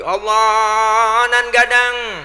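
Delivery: loud, high, long-held mock Padang takbir; takbir Padang lantang. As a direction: belt out the Minang prayer call and hold it. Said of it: "Orang Padang kencangkan lagi". (takbir ala Padang, lantang melengking panjang)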